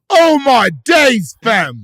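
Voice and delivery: loud, drawn-out mock British slang; high, slow British exclamation; British accent. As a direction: exclaim it as a hammed-up British reaction guy. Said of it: "Or if they're British".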